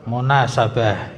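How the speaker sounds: normal talking voice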